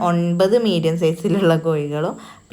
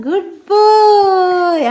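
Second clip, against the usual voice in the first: drawn-out praise; 13 semitones higher, 10 dB louder, much slower than usual. Real, not acted. Praising toddler after animal sound.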